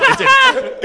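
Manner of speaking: high, loud laughing denial; laughing high protest. Real, not acted. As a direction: squeal it out through a laugh. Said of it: "No, I'm kidding".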